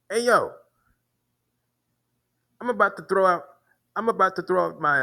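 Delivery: high-pitched imitation of the angry man; imitating the man. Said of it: "that's how he talks".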